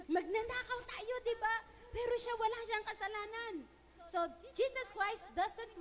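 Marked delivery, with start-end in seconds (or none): 0.1-3.5 other thief. Retold or imitated. Repentant thief confesses.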